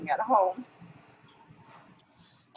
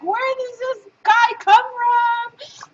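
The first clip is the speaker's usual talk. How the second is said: amazed; 13 semitones higher, 5 dB louder, much slower than usual. Retold or imitated. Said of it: "And it's like".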